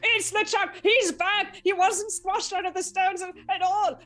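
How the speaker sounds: high, loud panicked yelp; a scared dog in a hurry. Acted. Dog character panics at LeChuck.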